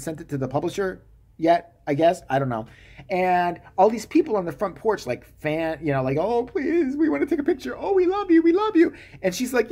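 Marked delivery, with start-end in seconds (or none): 6.2-8.9 much higher, lightly voiced fawning fans; mock gushing fans. Fans beg the writer for pictures, mock gushing. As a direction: gush like starstruck fans.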